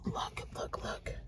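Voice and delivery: very soft, repeated whisper; excited whisper. Real, not acted. Vlogger showing all four magazines, excited whisper. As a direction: whisper it as if too thrilled to speak.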